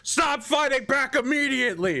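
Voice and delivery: high shouted order while swinging; shouting mid-fight. Retold or imitated. Paladin slashing at kobolds while shouting orders. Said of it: "You're like".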